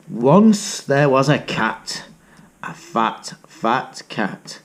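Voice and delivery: slow, hushed read-aloud; hushed picture-book telling; storybook narration. A narrator's reading.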